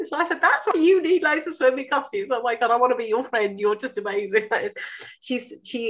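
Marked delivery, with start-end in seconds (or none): 0.4-4.5 high, quick fan gushing; giddy admiration. Retold or imitated. Telling Louise she wants to be friends, high and giddy. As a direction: gush like you've met a hero. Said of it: "practically hyperventilating with excitement".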